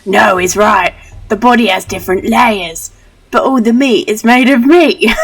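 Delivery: put-on loud, high child character; insistent kid. Child backing a friend about meat and layers, loud and insistent. Voices child arguing in game.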